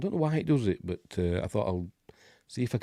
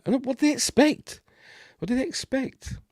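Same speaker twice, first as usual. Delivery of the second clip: very high-pitched incredulous question; exasperated disbelief. Real, not acted. Streamer on commenters' excuses, exasperated disbelief. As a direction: squeak it out in exasperation.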